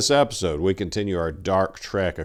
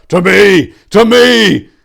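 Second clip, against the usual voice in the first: loud high shout to gather the men; rallying cry; 13 semitones higher, 18 dB louder, slower than usual. Acted. Tor rallying the monks around him, loud rallying cry. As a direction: call the brothers to your side at full voice. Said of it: "Tor cried out".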